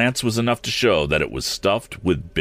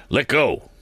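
higher-pitched cry of command; 6 semitones higher than usual. A narrator's reading. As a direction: call it out sharply as an order, not at full volume. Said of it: "cried Morse".

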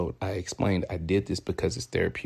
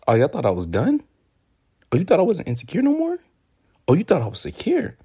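mock taunting; 10 semitones higher, 4 dB louder, faster than usual. Real, not acted. Mocking own insecurity.

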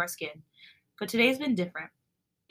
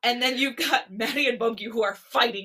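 laughing; 4 semitones higher, 8 dB louder, slower than usual. Real, not acted. Laughing while recapping couples.

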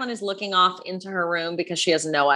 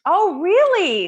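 surprised; 9 semitones higher, 6 dB louder, much slower than usual. Real, not acted. Surprised by friend's claim.

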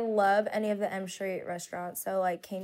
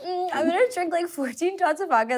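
mocking; 7 semitones higher, 4 dB louder than usual. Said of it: "it's like".

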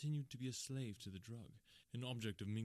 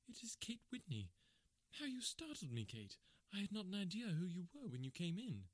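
much higher woman's voice, relieved surprise; startled wife; 9 semitones higher than usual. Acted. Wife greeting her old friend Kate, high startled voice.

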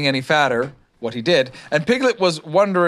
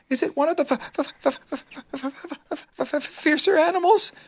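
high, stuttering little Piglet; frightened stammer; 13 semitones higher than usual. A narrator's reading. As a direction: stumble nervously on the first sounds, pitched high. Piglet fears fiercer animals.

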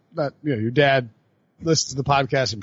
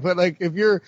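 ordinary talk

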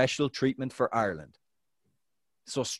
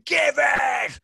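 imitated high, loud yell for the ball; screamed demand; 12 semitones higher, 4 dB louder than usual. Footballer retelling teammate screaming for the ball, loud shout. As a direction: bellow it like a teammate demanding a pass. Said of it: "He screamed at me so loudly".

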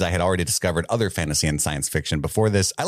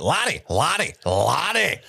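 Lottie repeated in a halting Scottish accent, pitched higher; Scottish accent warm-up; 6 semitones higher, slower than usual. Shown as a demonstration. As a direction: drill Lottie in a Scottish accent, getting the tongue used to it. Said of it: "trying so hard to read this email in a Scottish accent".

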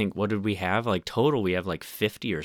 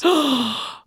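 delighted gasp; 5 dB louder than usual. Real, not acted. Remembering a loved film.

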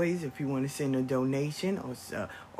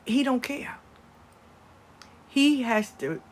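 normal talking voice

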